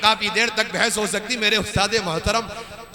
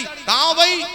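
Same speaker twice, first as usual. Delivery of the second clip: raised, questioning mock phone call; calling after someone; 9 semitones higher than usual. Voter phoning the missing politician, calling out. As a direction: act out a caller chasing someone up.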